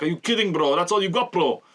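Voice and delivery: high, mocking Eastern European thug voice; Eastern European gangster accent. Gangsters jeering at a failed attack, Eastern European accent. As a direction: taunt them in a hammed-up Eastern European accent. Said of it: "doing Eastern European gangster accents".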